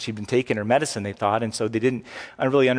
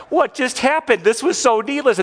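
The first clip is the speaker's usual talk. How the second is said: high, loud exasperated outburst; frustrated dad; 11 semitones higher, 5 dB louder than usual. Retold or imitated. Dad seeing photos of wrecked cars, mock frustrated. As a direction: replay your frustration at the crash. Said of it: "I got more upset".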